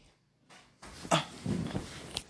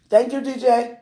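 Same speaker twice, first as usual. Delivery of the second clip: loud, higher thanks; calling out; 11 semitones higher, 11 dB louder than usual. Real, not acted. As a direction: sing out the thanks.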